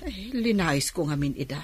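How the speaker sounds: grouse quietly so no one hears; bitter muttering alone after the niece leaves; muttering to herself. Acted. Aunt grumbling alone after visit.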